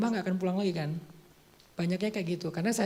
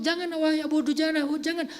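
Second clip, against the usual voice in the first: pleading, pitched up, begging him to stop; urgent raised plea, half acted; higher pleading call; 7 semitones higher than usual. Rasulullah urging Abu Dujanah not to shield him, in a higher pleading voice.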